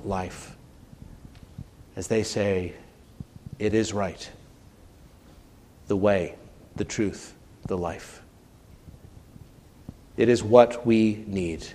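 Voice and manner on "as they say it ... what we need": soft, low, hushed prayer; quiet prayer. Acted. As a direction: let it fade to a hush.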